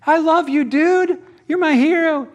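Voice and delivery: high, loud adoring shout; gushing fan yell. Retold or imitated. Fan yelling across the street to his baseball hero. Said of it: "instead of yelling".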